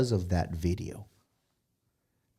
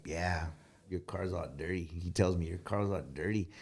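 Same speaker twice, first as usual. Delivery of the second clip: mock smug friend; 5 semitones lower, 12 dB quieter than usual. Quoting clean-freak friend.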